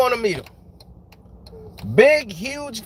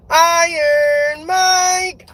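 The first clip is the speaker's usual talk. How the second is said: slow, drawn-out hype chant with a laugh; mock background singer; 6 semitones higher, 4 dB louder, much slower than usual. Imitating the intro singer, slow drawn-out chant. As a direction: stretch the name out like a cheesy backing vocal. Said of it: "singer going, 'Iron Mike'".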